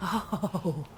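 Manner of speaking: theatrical fake gasps of discovery; mock gasps. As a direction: gasp as if suddenly seeing the truth, hammed-up. Mock realization before sarcastic speech.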